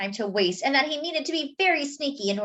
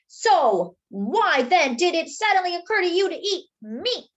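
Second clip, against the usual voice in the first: demanding; 7 semitones higher, 4 dB louder than usual. Acted. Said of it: "Arnie demanded".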